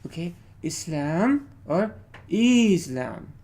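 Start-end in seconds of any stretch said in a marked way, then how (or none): 0.6-1.5 "Islam" with "lam" stressed and rising; short "Is", then lift "lam" in "Islam"; first "Islam" of a contrast, weight on the end; second-syllable stress. Shown as a demonstration. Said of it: "there are two ways to pronounce this". 2.3-3.3 "Islam" with a long, loud "IZ" and falling "lam"; contrasting "IZ-lam", front-heavy and falling; first-syllable stress. Shown as a demonstration. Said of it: "Or you can go".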